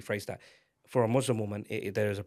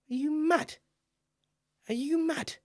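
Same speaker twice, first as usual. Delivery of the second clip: high, shrill-pitched mock outrage; mock disbelief, pitched way up; mock outraged shriek; slower than usual. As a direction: squeal it like an incredulous auntie. Mocking skin-lightening cream buyers.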